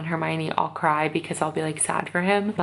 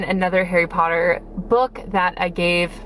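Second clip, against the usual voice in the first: ordinary talk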